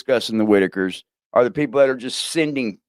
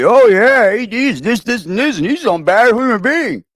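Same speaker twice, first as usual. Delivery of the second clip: high-pitched mock gossip voice; mocking imitation; 8 semitones higher, 3 dB louder than usual. Streamer mimicking his ex supposedly running him down, high mocking voice.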